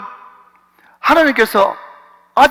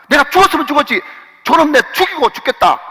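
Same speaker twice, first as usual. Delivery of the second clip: mock furious David; 8 semitones higher, 6 dB louder than usual. David voiced in anger.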